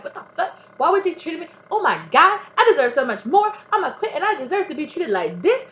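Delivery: high, loud indignant rant; hot and wronged, voice climbing; retold outburst. Acting out her angry early self. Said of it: "you're angry at first because you're like".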